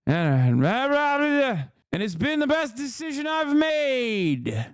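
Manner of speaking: hammed-up elderly president voice, high and strained; Joe Biden impression. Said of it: "Joe Biden said".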